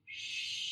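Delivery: soft sustained shushing exhale; long quiet shh breath. Shown as a demonstration. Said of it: "I want you to exhale making a".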